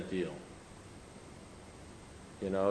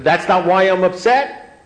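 loud, high, drawn-out indignant question; incredulous outrage; 8 semitones higher, 11 dB louder, slower than usual. Retold or imitated. Man echoes sponsor's verdict back, incredulous outrage. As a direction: throw it back in total disbelief.

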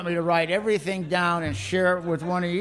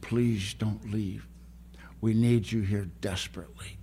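retold whisper; 5 semitones lower, 6 dB quieter than usual. Old woman hugging his neck, softly pleading, mock whisper. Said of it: "She's whispering in my ear".

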